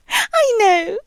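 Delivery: high giggles around "I know"; giggly mock sympathy; giggling. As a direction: laugh through the words, teasing. Laughing at listener's discomfort.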